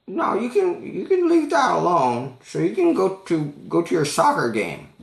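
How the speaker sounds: put-on old lady shooing him off; high-pitched insistent old woman. Old lady voiced in story.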